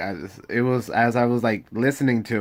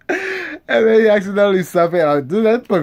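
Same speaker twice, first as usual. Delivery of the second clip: laughing; 11 semitones higher, 7 dB louder than usual. Real, not acted. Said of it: "laughing through story".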